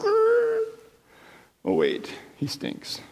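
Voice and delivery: high squeal then hushed aside; comic Martha. Acted. Martha balking at the tomb, squeal then hushed. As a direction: squeak "Oh," then drop to a hush for "wait, he stinks". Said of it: "that's where the record kind of scrapes around".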